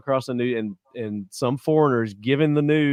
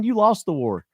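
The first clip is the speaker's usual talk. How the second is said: quoted catchphrase; 7 semitones higher than usual. Retold or imitated. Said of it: "Is that what you always say".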